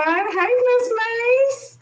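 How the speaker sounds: high, slow, stretched sing-song hey; sing-song call-out. Real, not acted. Host greeting her co-host, high and sing-song. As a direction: call out to her like a friend across the room.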